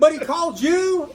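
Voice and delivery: loud, high, incredulous question; imitated disbelief. Customer still can't accept the call, loudly incredulous. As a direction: cry it out as the dumbfounded fan.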